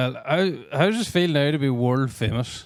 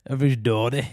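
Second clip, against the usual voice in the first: Fermanagh border accent, Dotty said broad with a flat vowel; Everybody's Dotty in a broad border accent; faster than usual. Shown as a demonstration. Explaining the border word for daddy, Fermanagh border accent. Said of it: "They're very raw".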